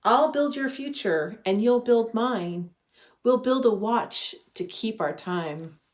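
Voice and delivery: normal talking voice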